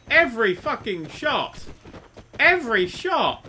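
loud, slow, outraged yelling at the enemy; furious shout. Real, not acted. Gamer yelling at an accurate enemy, furious shout. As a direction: bellow it, drawn out and disbelieving. Enemy hitting every shot.

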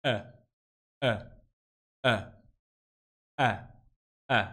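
E said from the throat with the mouth shaped for A; short, low throaty "E"; throaty schwa. Shown as a demonstration. Teacher demonstrates the schwa for learners, throaty E. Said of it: "decir una E con la garganta".

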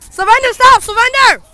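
high, loud command to surrender; loud yell. Real, not acted. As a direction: bellow it across the field. Kids' game, yelling commands.

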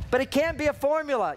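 usual speaking voice

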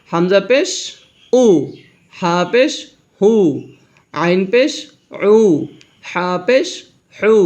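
rhythmic letter chant with lifted endings; recitation drill. Shown as a demonstration. Teacher leading everyone through pesh sounds, rising sing-song drill. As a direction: sound out each spelling for the class, evenly spaced. Asks all to recite along.

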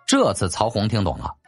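usual speaking voice

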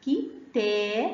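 كي then تا with a long "aa"; slow syllables, تا drawn out; long alif sound. Shown as a demonstration. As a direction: sound it out slowly, stretching the vowel of تا. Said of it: "مد بالألف".

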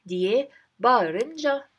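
ordinary talk, nothing marked

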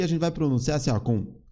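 normal talking voice

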